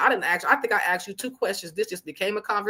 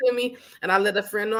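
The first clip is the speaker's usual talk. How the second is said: usual speaking voice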